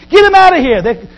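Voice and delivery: high, loud outburst easing off; acted outrage, loud at the start; angry mob. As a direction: shout it like an indignant crowd, then settle. Acted angry crowd in Philippi.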